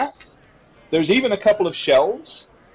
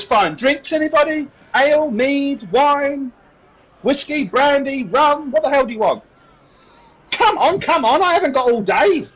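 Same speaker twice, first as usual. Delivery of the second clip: put-on high-pitched serving girl listing drinks; harried barmaid, voice pitched right up; exasperated barmaid; 11 semitones higher than usual. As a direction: lose patience with dithering customers.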